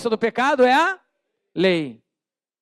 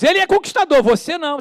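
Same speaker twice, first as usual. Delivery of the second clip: high, loud mocking taunt; taunting devil; 6 semitones higher, 5 dB louder than usual. The devil taunts the believer, loud high mockery. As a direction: sneer like the devil. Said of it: "O diabo diz".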